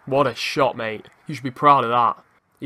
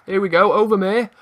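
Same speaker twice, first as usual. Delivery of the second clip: loud, higher live match excitement; rising excitement; 5 semitones higher, 5 dB louder, faster than usual. Real, not acted. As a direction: urge the attack on as it builds. Attack building towards goal.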